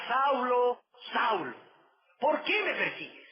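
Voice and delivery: heavenly voice calling. Acted. Voice from heaven calls Saul on the road, calling out. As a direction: call out like the voice from the sky.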